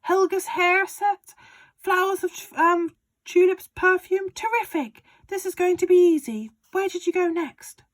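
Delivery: high, loud, gleeful father; excited Mister Cranky. Acted. Father urging George on about the medicine, high and loud. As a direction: crow it like a man with a scheme.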